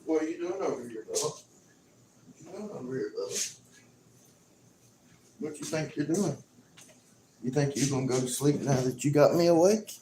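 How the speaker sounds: high, soft coo to a cat; teasing the cat who woke him, high and soft; pet talk. Real, not acted. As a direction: sweet-talk the drowsy pet in a high, hushed voice. Cooing at the sleepy cat Bubba.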